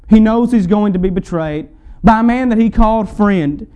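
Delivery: fervent pulpit build; preaching at full force. Acted. Preacher builds again, troubled Lord.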